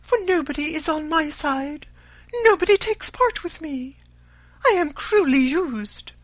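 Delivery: high, self-pitying lament; melancholy. A narrator's reading. Mother complaining nobody takes her side, melancholy. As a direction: wail plaintively in a high voice. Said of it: "she added in a melancholy tone".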